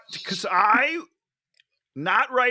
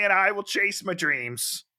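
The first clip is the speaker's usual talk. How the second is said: normal talking voice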